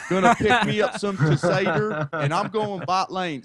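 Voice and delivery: put-on high, loud country accent voice; hammed-up country accent, gleeful; thick country accent. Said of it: "I like to have a thick country accent".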